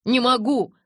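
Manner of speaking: loud, high, strained refusal; through clenched teeth. A narrator's reading. Jacob answers Bella's warning, through clenched teeth. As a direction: force it out through gritted teeth. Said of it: "сквозь стиснутые зубы".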